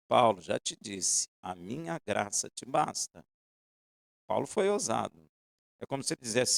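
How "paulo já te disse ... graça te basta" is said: a lower, slow, settled divine reply; God answering Paul firmly; God's voice. Acted. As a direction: answer Paulo calmly, lower.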